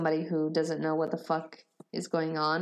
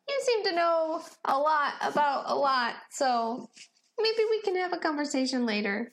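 put-on lightly raised, earnest young Benny; a gentle, hopeful character voice, a bit higher; Benny's voice; 7 semitones higher than usual.

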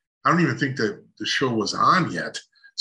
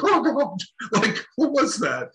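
mock stunned; 10 semitones higher than usual. Stunned reaction to Ronnie's laugh.

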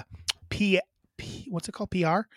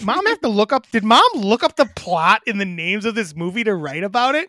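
louder, higher pitched disbelief; incredulous; 4 semitones higher, 5 dB louder than usual. Real, not acted. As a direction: accuse mom of looking it up. Mock outrage at mom's plot summary.

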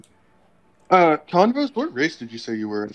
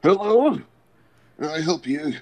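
put-on old man greeting; 3 semitones higher than usual. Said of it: "and he says".

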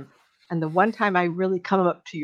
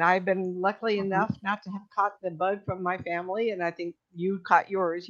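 usual speaking voice

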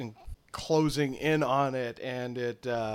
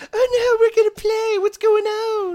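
very high, loud squeaky-pitched creature voice; excitable pet creature; 7 dB louder than usual. Acted. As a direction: play an alarmed little critter, pitched way up. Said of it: "Kokolo is now kind of freaking out".

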